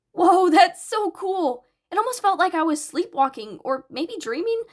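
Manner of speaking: put-on high, loud and wide-eyed boy; amazed excitement. Izuku amazed after being brainwashed.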